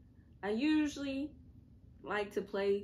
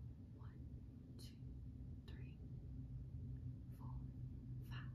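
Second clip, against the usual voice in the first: whispered counting; 6 semitones lower, 14 dB quieter than usual. Pretend.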